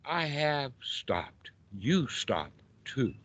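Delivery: slow, soft, measured reply; Buddha answering. Acted. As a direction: answer the shout quietly, word by word. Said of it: "The Buddha says".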